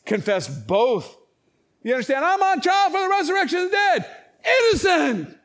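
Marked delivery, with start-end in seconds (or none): 2.2-5.4 high, loud courtroom protest; crying out. Acted. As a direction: shout your defence to the whole room. Acting out Paul's loud defence.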